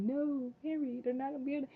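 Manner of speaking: high-pitched, lightly acted plea to a son; mock anguished mother. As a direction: imitate a mother holding her boy back. Acting Regina stopping Henry.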